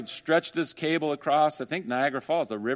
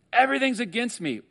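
whining Jacob; 10 semitones higher, 3 dB louder than usual. Acted. Voicing Jacob's complaint.

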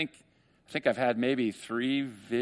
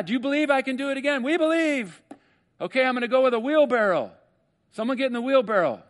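higher, louder acrobat daring the crowd; showman's challenge; 11 semitones higher, 5 dB louder than usual. Retold or imitated. Acrobat daring the crowd to get in, higher and louder. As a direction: dare them, much higher and louder.

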